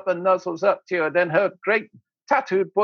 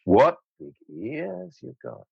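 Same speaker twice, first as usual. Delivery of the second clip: slow, lowered mock come-on; 5 semitones lower than usual. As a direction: draw it out like a pretend temptress. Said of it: "she's coming on to me".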